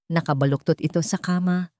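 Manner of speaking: ordinary delivery, nothing marked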